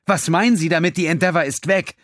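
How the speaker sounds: shouting disbelief over the storm noise. A narrator's reading. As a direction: shout to be heard over thunder. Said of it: "sich selbst kaum schreien hören".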